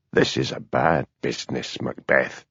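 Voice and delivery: low, soft and cold with contained anger; quiet fury. A narrator's reading. Superintendent rebuking Hamish, quietly furious. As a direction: menace held under the breath. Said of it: "in the quiet voice he used when he was really furious".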